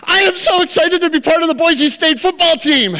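high, loud, beaming delivery; thrilled rookie. Retold or imitated. Rookie answers irritated coach, high and loud, gushing. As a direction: overflow with joy at making the team. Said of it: "He goes".

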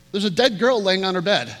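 normal talking voice

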